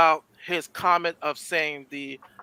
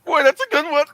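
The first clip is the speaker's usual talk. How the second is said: much higher, loud put-on voice; Michael Richards; 10 semitones higher, 7 dB louder than usual. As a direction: burst out with it as an impression. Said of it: "Give us your Michael Richards".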